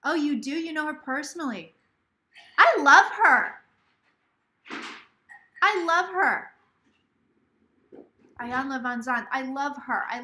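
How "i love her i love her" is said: loud, raised burst of real adoration; enthusiastic gushing. Real, not acted. Host hears viewer knows her personally, gushing. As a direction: blurt it out, gushing.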